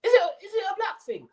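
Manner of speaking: squeaky, very high-pitched mock voice like he swallowed a mouse; mouse-voiced parody of a footballer; mocking Sol Campbell voice. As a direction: pitch way up and squeeze it, mocking him. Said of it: "Why the fuck does he sound like that?".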